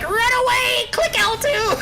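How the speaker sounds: very high squeaky panicked cry; panicked teammate impression. Mocking a teammate who fled after one poke, high panicked squeal. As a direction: squeal it like a scared teammate fleeing. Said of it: "Got poked once and is like".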